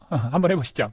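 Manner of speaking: usual speaking voice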